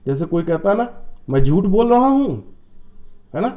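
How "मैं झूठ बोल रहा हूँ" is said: "मैं झूठ बोल रहा हूँ" asked back in disbelief, higher and louder; questioning tone. Shown as a demonstration. Teacher showing a statement asked as a question, incredulous tone. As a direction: challenge it as a question. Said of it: "इसका मतलब वो पूछ रहा है".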